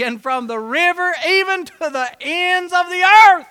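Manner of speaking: loud preacher reading; preaching scripture. Acted. Preacher reading Zechariah to the church, loud preaching. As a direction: proclaim the prophecy.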